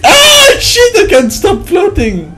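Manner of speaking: high yelp easing into complaint; startled, high-pitched dismay; alarmed outcry. Real, not acted. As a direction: cry out high, then wail the words. Alarm at floating in game.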